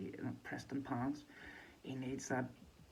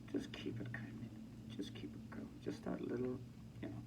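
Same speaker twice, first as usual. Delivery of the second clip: hushed whispered warning, imitating a security guard; 6 dB quieter than usual. Security guard quietly warning the fighter to keep cool, whispered.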